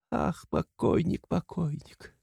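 high, hushed lament; plaintive weeping. A narrator's reading. Katerina calling to her late husband, soft weeping lament. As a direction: moan softly to the dead, drawn out. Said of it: "Плач бедной, чахоточной, сиротливой Катерины".

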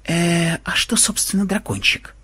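high, soft, feigned innocence; shifty evasion. A narrator's reading. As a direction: dodge the question, playing innocent. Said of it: "Глаза программиста забегали". (уклончиво, тихо и высоко)

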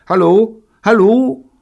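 very high, loud rising calls; calling out. A narrator's reading. Neil losing radio contact, loudly calling. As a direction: call repeatedly into a dead line.

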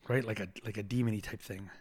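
normal talking voice

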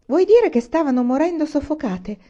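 appalled, pitched well up; horrified. A narrator's reading. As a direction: realise it with horror, voice rising. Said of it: "Era orripilata".

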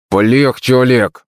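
plain reading voice

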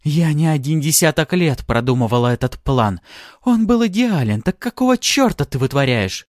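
high, indignant tirade; wrathful. A narrator's reading. Artara on her decades-long plan being ruined, furious. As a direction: rail angrily at the saboteur. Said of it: "гневно прошипела она".

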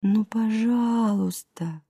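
soft, slow, pleading stretch of the words; drawn-out plea. A narrator's reading. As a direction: beg quietly, dragging out each word. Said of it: "протянула Аглая".

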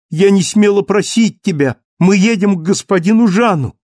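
high, loud, happy rush; joyful exclamation. Acted. Cosette overjoyed they go to Jean, high and happy. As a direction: burst out with delight.

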